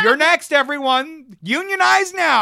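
loud, high-pitched mock call to arms; rallying shout. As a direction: holler it to a whole building. Imagined rallying shout to coworkers.

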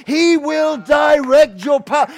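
loud, high, slow declaration; forceful proclamation. Real, not acted. Preacher promising guidance to the church, forceful proclamation. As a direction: hammer out each word at full preaching force. Sermon climax, same promise repeated.

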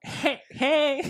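high, laughing pirate greeting; Jack Sparrow impression. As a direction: play a drunken pirate, giggling. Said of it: "I'm gonna become Captain Jack Sparrow".

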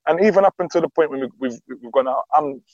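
ordinary delivery

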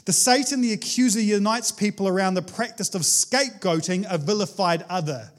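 ordinary talk

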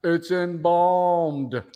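echoey empty room; cave echo. Real, not acted. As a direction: voice bouncing off bare walls. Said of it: "you sound like you're in a cave".

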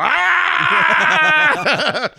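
high, loud held scream; hammed-up horror-movie scream; mock killer scream. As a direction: wail like a movie maniac. Imagining himself as chainsaw killer.

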